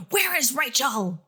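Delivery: lightly imitated drunk caller. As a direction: mimic a drunk hunting for someone. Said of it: "you know what you sounded like?".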